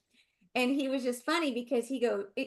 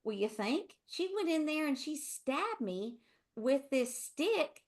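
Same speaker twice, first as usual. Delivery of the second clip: slow, outraged Southern husband; lightly hammed-up Southern complaint; husband's Southern drawl; 5 semitones higher, slower than usual. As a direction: stretch it out like an aggrieved Texan. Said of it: "He goes".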